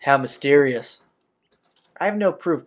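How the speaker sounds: usual speaking voice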